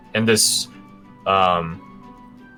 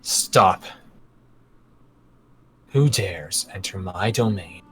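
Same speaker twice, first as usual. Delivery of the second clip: cold, slow regal voice; icy ruler demanding answers; cold queen; 4 dB quieter, slower than usual. Acted. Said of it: "She asks who dares enter her domain".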